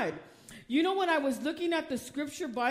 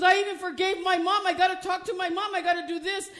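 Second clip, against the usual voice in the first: excited granddaughter; 6 semitones higher, 5 dB louder, faster than usual. Retold or imitated.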